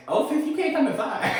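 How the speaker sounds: laughing imitation of a scared girl; laughing mock quote. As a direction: giggle while playing the panicked worker. Quoting the screaming student worker.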